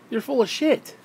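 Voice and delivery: pushed up in pitch for emphasis; emphatic second take. Real, not acted.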